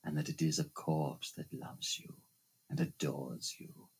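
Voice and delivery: a hushed low menacing growl; low menacing phantom. Acted. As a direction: press each word in a dark hush. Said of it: "he shouted".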